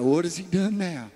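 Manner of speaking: sneering mock of the special one; jealous, scoffing sarcasm; mocking the favorite. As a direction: roll your eyes at the golden boy. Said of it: "Everybody's like".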